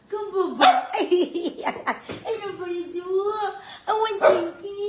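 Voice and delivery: giggly cooing. Real, not acted. A mother giggling while cooing to her baby.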